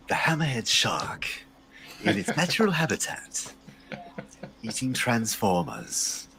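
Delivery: slow, hushed nature documentary narrator; David Attenborough. Pretend. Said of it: "you have to do the David Attenborough".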